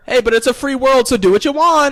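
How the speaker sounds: high, loud cheering interjection; hyped-up hype man. Pretend. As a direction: jump in with manic energy. Hype man joins the hyper bit.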